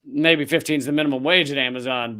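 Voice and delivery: normal talking voice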